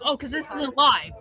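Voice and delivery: normal talking voice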